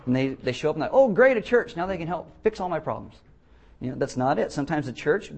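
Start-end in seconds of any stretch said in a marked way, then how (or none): 0.9-3.2 mock delight. Mimics needy newcomers to church.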